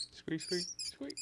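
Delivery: high squeaky mouse noise; little squeaks way up high. Acted.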